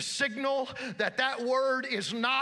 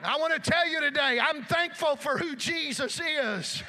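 higher, impassioned sermon shout; full-force preaching; 6 semitones higher, 3 dB louder than usual. Real, not acted. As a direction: preach it high and ringing to the congregation.

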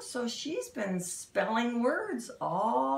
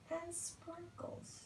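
hushed storytime whisper; secretive whisper; 9 semitones higher, 17 dB quieter, slower than usual. Acted. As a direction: lean in and whisper the clue. Building mystery in story.

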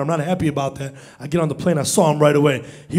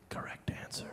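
very soft whispered aside; 26 dB quieter than usual. Real, not acted. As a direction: whisper it into the mic.

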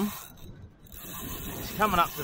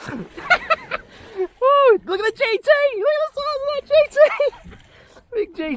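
much higher, loud squealing excitement; excited shout; 7 dB louder than usual. Real, not acted. Angler spotting a giant trevally, squealing loud and high. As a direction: squeal with delight at the big fish.

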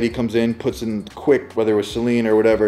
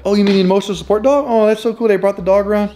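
cheerful nurse; 9 semitones higher, 4 dB louder, faster than usual. Retold or imitated. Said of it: "she was like".

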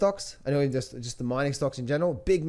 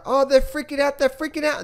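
much higher, squeaky mock-panicked voice; 11 semitones higher, 4 dB louder than usual. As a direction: parody the traders losing their minds, way up high. Mocking panicking market crowd.

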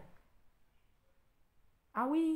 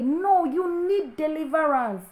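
mocking quote; 6 semitones higher, 6 dB louder, slower than usual. Pastor mocking the church member who told her she needs deliverance, slow and higher. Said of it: "they think it's okay".

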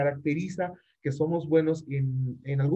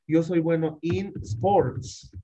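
slow literal translation; 2 semitones higher, much slower than usual. Shown as a demonstration. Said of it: "In, ¿no es cierto?".

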